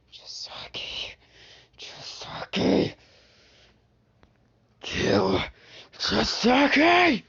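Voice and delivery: starts as a slow whisper, ends in a high shout; whisper building to a scream. Acted. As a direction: creep from a hiss to a cry. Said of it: "It's getting louder and louder".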